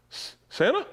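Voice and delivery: high, stammered little question; imitated awestruck boy. Boy answers Santa on the phone, high and hesitant. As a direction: stammer it high like an amazed child. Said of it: "And he's like".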